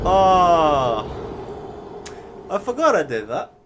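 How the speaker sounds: high, loud burst of surprise at my own blunder; suddenly remembering, with a rising laugh in the voice; surprised realization. Real, not acted. Reacting to own past blunder.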